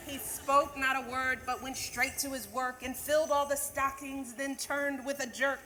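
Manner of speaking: lively storybook reading of a Christmas poem; a teacher reading The Night Before Christmas to the class; reading to children. Acted. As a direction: read it like a bedtime story to kids.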